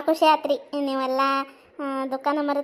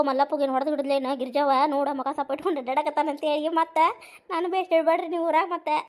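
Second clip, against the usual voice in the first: ordinary delivery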